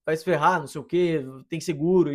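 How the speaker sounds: loud, furious mock shout at the old man; hammed-up road rage reply; what he could have shouted angrily at the old man; pretend angry yell. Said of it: "chegar pra ele puto e falar".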